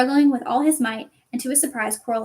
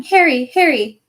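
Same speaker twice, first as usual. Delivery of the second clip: loud calling of Harry; crying out; 3 semitones higher, 9 dB louder than usual. Acted. Voices calling Harry in his head, loud. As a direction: call him from far away.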